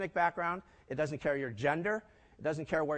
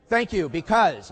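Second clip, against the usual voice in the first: mock parental; 9 dB louder, slower than usual. Mocking bank's parent-like answer.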